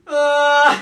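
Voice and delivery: high, loud hysterical cry; hammed-up wail-laugh. Kid breaking down in front of mum, loud. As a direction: let out a mad wail.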